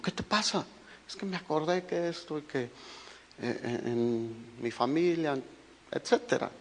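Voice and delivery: hushed retelling of a private conversation; soft retold exchange. Husband quoting his talk with a crying wife, softly. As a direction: say it softly, as a quote.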